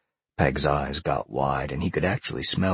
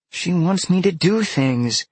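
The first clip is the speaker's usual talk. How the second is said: put-on high, louder girl's wail of complaint; exasperated girl; 5 dB louder than usual. A narrator's reading. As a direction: repeat it louder, high-pitched and insistent. Repeats complaint more emphatically.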